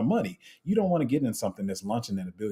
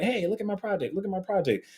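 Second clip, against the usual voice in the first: higher, pushy pitch of a hawker handing out flyers; mock hawker; 10 semitones higher than usual. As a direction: lightly play a salesman begging for attention.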